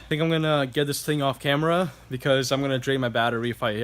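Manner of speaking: normal talking voice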